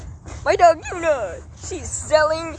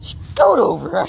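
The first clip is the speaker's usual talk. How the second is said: dog voice; 4 semitones lower, 6 dB louder, slower than usual. Pretend. Voicing the dog.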